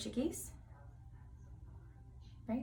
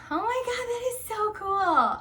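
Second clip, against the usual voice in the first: much higher, excited gush; delighted squeal; 12 semitones higher, 6 dB louder than usual. Real, not acted. Vlogger admiring her hair in the mirror, high excited gush. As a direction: let it burst out high with delight.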